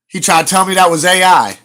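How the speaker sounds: loud, high, disbelieving; indignant exclaim. Real, not acted. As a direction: push it out in outrage. Indignant retelling.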